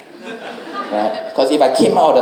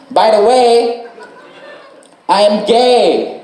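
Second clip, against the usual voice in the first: much higher, louder, then very slow confession; driver coming out; 11 semitones higher, 4 dB louder, much slower than usual. Retold or imitated. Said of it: "and then he goes".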